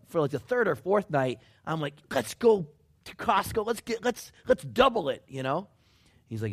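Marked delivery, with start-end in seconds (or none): 2.1-5.2 high, excited retold urging; rally everyone, pitched well up; eager urging. Retelling own eager go-ahead on spending.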